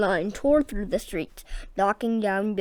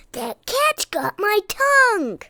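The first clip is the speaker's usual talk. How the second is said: much higher, louder mouse cry; panicked squeak; 12 semitones higher, 9 dB louder than usual. A narrator's reading. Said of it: "squeaked the butcher".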